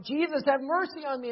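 mock yell for mercy; yelled plea. Crowds crying out to Jesus for help, yelling. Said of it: "The crowds are yelling out".

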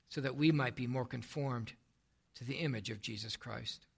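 soft, low, unhurried praying; prayer. Real, not acted. Preacher prays to Father before teaching the word, softly in prayer. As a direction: sink gradually into a hushed, reverent close.

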